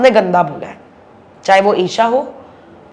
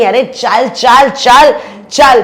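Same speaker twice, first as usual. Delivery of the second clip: rising, louder, scornful "अरे चल, चल, चल"; imitating a quarrel, each "चल" pushed louder; mocking dismissive shout; 2 semitones higher, 5 dB louder, much slower than usual. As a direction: brush her off with a growing jeer. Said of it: "जैसे वो लड़ी".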